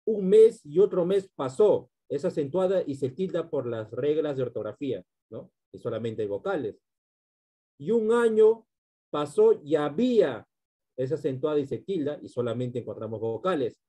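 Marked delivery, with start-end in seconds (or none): 0.1-1.9 higher, louder line of verse; verse recitation. Shown as a demonstration. Teacher reciting a verse example to students, raised recitation. 7.8-10.5 raised, louder recital of a verse; verse recitation. Shown as a demonstration. Teacher reciting a poem's next line to students, declaimed.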